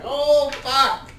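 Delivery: sudden high pained curse; pained yelp. Pretend. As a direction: yelp it like you just got stabbed. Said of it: "and you're like".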